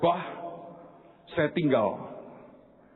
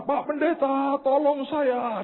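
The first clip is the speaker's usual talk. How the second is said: high-pitched mock crying plea; imitated tearful wailing; 13 semitones higher, slower than usual. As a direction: imitate a woman begging in tears, pitched way up. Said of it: "Dia teriak nangis keras". (menirukan ibu menangis memohon)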